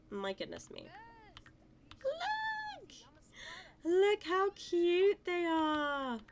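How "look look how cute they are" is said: much higher, louder, slow gushing squeal; excited squeal. Real, not acted. Gamer squealing at two cute horses. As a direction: squeal it out with delight.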